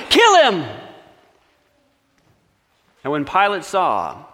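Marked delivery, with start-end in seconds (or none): 0.1-0.7 high, loud shout; loud high furious yell; mob cry. Acted. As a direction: scream it as the crowd. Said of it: "they cried out all the more".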